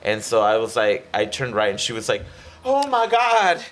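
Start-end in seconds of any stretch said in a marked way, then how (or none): 2.6-3.6 high, slow, alarmed Oh my God; mock panic. Examiner at the wrong turn, mock high panic. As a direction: play the examiner losing it, drawn out.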